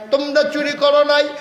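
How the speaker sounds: high, loud chanted exhortation; rally the congregation with a ringing cry; full-force preaching. Real, not acted.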